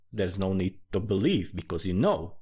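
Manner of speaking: usual speaking voice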